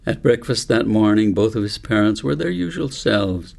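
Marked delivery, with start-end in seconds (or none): none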